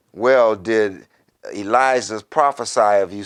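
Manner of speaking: usual speaking voice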